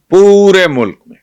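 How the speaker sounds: پورے stretched out high and loud; emphatic repeat. Real, not acted. Host stressing to viewers it is nationwide, emphatic. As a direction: swell hard on "پورے", then fall through "ملک میں".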